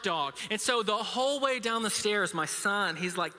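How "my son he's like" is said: ordinary talk, nothing marked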